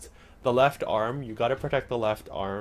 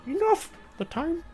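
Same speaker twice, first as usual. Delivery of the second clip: squeaky, much higher little master's croon; Yoda. Acted. Streamer trying out game dialogue as Yoda, high put-on voice. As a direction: attempt the Jedi master in a very high voice. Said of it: "if you read that with Yoda's voice".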